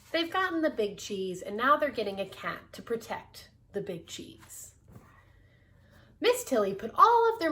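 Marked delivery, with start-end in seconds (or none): none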